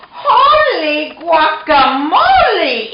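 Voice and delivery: high, loud, stretched-out amazement; astonished exclamation. A narrator's reading. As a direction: exclaim it with drawn-out, hammed-up wonder. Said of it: "exclaimed Skippy John Jones".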